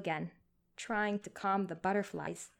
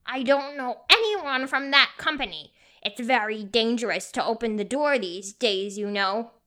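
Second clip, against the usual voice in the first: put-on higher, much louder, whiny old woman; querulous old voice; 3 semitones higher, 10 dB louder than usual. A narrator's reading. Old woman warning through the door, querulous. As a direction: complain like a wary old lady. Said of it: "The voice was high-pitched and querulous".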